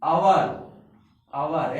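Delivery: "आवर" beginning straight on the vowel; silent H. Shown as a demonstration. Teacher tells students to say it so, "आवर" with silent H. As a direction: model "आवर" slowly for students, no H at the start. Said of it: "ऐसा बोल देना".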